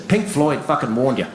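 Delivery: normal talking voice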